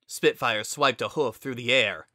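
usual speaking voice

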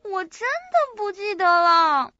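loud, drawn-out plaintive wail; distressed protest. Acted. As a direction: stretch it into a helpless, loud complaint. Pressed to remember, protests helplessly.

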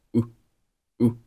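just the vowel "u", no h and no z; whose reduced to "u". Shown as a demonstration. Teacher drilling the weak form of whose as a bare "u". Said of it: "这个whose就读成了u".

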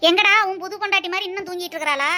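loud, fast nagging scold; mother-in-law barking at her son about the sleeping bride. Acted. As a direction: snap at your son about the lazy bride.

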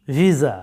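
ভিসা as vee-za, voiced z, pitch falling; correct pronunciation. Shown as a demonstration. Teacher corrects students' word, correct pronunciation. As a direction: model ভিসা with a z. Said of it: "সঠিক উচ্চারণটা হচ্ছে".

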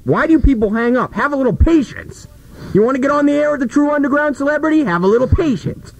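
high exasperated pleading. Real, not acted. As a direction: plead high and fast for patience. Host frustrated with hang-ups.

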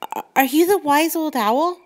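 put-on high, hesitant little girl; stammering child in awe; timid girl voice. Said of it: "Brooke asked".

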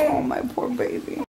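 soft whispered pity. Real, not acted. Mother whispering pity to her crying baby, whispered.

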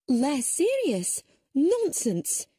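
high, slow, scornful disbelief; indignant old lady waving off the police; indignant scoff. Acted. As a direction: toss the idea aside as nonsense. Indignant rebuttal of police note.

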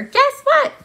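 put-on high, loud childish yelling; a little sister's shouted brag; excited yell. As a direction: call out across the bus like an excited little girl. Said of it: "yelled to another first grader".

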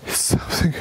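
tense breathless whisper. Real, not acted. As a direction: barely voice it, scared. Tense ghost hunt in tunnel.

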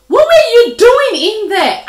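high, loud disbelief; shocked storybook dog; astonished question. A narrator's reading. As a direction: demand it loudly in amazement. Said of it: "asked the dog".

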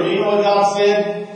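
usual speaking voice

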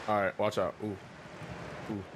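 usual speaking voice

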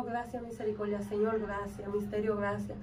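hushed grateful praying under the breath; thanking God softly on the beach; quiet prayer. Retold or imitated.

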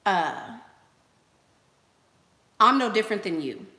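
ordinary talk

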